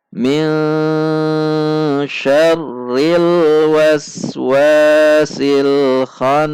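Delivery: slow melodic tartil chant; Quran recitation. Shown as a demonstration. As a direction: draw each syllable out in recitation. Teacher recites Quran verse.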